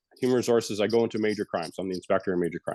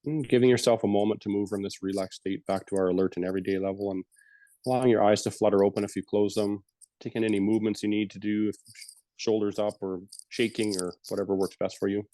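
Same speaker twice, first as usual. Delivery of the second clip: guided meditation. Acted. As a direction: ease the listeners gently back from the breath. Leads a closing meditation.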